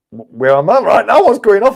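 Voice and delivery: high, loud bewildered alarm; mock panic. Traveller overhearing the gang's threat, loud mock panic.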